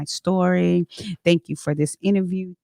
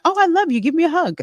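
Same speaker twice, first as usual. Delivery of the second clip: high, gushy self-quote; playfully effusive, much higher pitch; gushing affection; 12 semitones higher, faster than usual. Acted. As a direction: act out an eager hugger, voice leaping up. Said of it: "I'm the type of person like".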